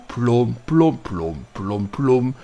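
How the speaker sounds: low, fading imitated engine plod; deep rumbling plom sounds; low mock engine noise. Imitating the truck's slow rumble.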